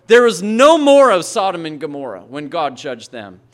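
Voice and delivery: the pastor building to a thundering point; full-force preaching. Acted. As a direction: preach it out with rising fire.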